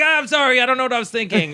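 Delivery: high-pitched frantic backpedal, re-enacted; pleading apology. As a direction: act out begging the boss for the job back. Re-enacts hurried apology call to owner.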